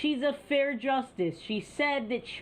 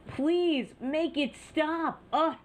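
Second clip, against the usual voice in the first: exasperated; 6 semitones higher, slower than usual. Real, not acted. Host exasperated by cackling.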